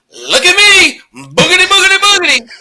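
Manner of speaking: put-on high, loud sing-song taunt of a boy on a limb; imitating a cocky kid up a tree; show-off kid. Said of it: "no hands".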